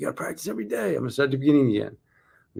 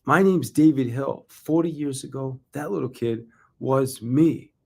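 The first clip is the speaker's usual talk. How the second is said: measured, building stage voice; keynote delivery; 3 semitones higher than usual. Shown as a demonstration. Speaker rehearsing full keynote for salespeople, stage-style motivational delivery.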